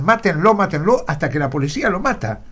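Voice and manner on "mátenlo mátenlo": mildly raised, higher-pitched imitated shout; retold crowd shout. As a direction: report the mob's chant with a touch of a yell.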